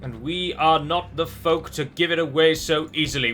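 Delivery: loud, slow, rallying the troops; rousing battle cry. Acted. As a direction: stir the army before battle.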